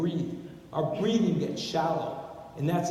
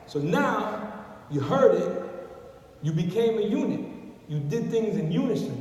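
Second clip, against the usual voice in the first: ordinary delivery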